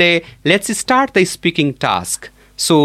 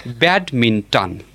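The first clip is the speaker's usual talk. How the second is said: "Badminton" slow and lower, stressed on BAD; stress on first syllable; 5 semitones lower, slower than usual. Shown as a demonstration. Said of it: "with the stress on the first syllable".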